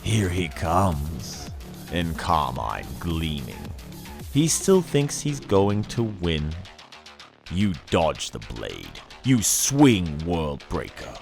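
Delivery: normal talking voice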